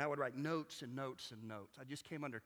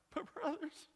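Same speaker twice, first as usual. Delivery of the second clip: mock voice crack; 12 semitones higher than usual. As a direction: mimic a preacher milking tears with a cracking voice. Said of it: "let your own voice crack at just the right time".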